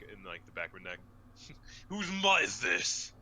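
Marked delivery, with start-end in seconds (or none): 1.9-3.1 angry shout. Acted. Barbarian snarling at dog.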